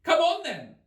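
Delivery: very high, loud royal demand; loud Queen. A narrator's reading. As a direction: bark the question at Alice. The Queen orders.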